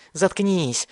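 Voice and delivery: high, crazed cry; frantic shriek. A narrator's reading. Mad boy silences Thomas, high frantic cry. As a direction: cut in with a high-pitched yell. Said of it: "завопил Бен".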